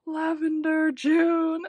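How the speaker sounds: very loud, high fan shriek; thrilled squeal. Real, not acted. Fan seeing June in lavender, thrilled squeal. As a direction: burst out with real delight. Sees favorite in favorite color.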